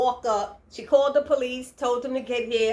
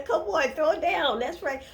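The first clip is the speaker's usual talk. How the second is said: ordinary talk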